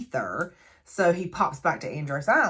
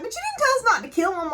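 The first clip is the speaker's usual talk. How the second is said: mock protest; faster than usual. Retelling the heroes arguing with Apollo.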